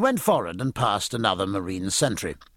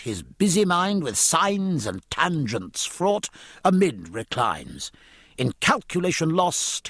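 measured, higher-pitched poetry delivery; declaimed rhyming verse; verse recitation; 7 semitones higher, slower than usual. A narrator's reading. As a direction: recite the verse with drawn-out line endings.

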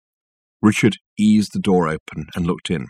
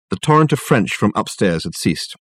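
usual speaking voice

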